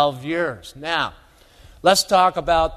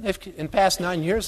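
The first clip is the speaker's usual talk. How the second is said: ordinary delivery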